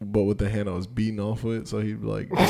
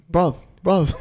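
higher, louder mock British dog; British accent; 7 semitones higher, 7 dB louder than usual. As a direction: call out like a British bruv. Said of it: "and he's like".

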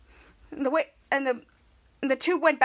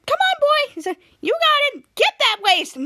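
much higher, louder, eager cheer; excited cartoon voice; 11 semitones higher, 5 dB louder than usual. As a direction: cheer him on in a high excited voice. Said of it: "Cut to Wasty going to the door and said".

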